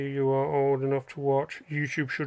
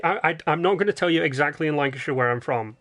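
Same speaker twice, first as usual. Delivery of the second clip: normal talking voice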